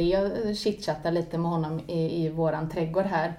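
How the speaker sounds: normal talking voice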